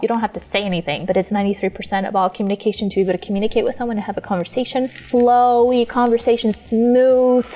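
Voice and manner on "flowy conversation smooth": slow, drawn-out words; smooth and flowy. Shown as a demonstration. Drawing out words to illustrate flow.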